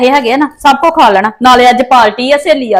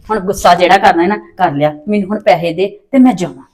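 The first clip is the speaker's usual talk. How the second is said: coaxing; 7 semitones lower than usual. Acted. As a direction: sweet-talk her mother gently. Daughter coaxing mother for money.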